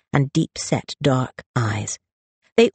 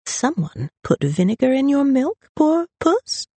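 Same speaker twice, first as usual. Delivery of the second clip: high, mock-sympathetic coo; sing-song needling, rising at the end; taunting brother; 12 semitones higher than usual. A narrator's reading. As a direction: tease her like a sulky cat. Brother keeps taunting his sister.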